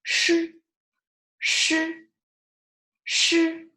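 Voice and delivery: "Shi, shi, shi" high and level, tongue curled. Shown as a demonstration. Teacher repeats for learners, "shi" high with curled tongue. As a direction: curl the tongue back for each "shi". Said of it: "so it's curling your tongue".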